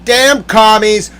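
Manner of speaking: slow, loud, high curse; getting mad. Real, not acted. Working oneself up before bed, mad. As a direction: yell it drawn out. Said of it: "I'm getting all mad".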